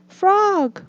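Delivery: high, distressed Toad; anxious cry. A narrator's reading. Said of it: "cried Toad".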